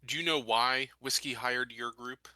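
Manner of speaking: ordinary talk, nothing marked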